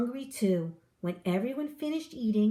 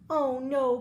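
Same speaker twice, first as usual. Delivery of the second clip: a mildly dismayed "Oh no", a touch louder; a small cry of alarm; cried out; 4 semitones higher, 4 dB louder than usual. A narrator's reading. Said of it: "cried Missus Spider".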